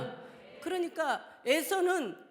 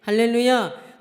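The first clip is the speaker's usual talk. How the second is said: loud hallelujah; 10 dB louder, slower than usual. Real, not acted. Preacher's acclamation.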